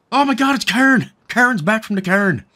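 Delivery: high, excited mock-Irish voice; cairn voice. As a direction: ham up an Irish announcement of a returning friend. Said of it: "in that cairn voice".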